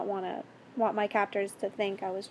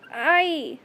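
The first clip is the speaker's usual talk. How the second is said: high, loud cry of pain; short acted scream; 6 semitones higher, 7 dB louder than usual. A narrator's reading. Captive cries out as captor hurts her, pained scream. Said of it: "I screamed".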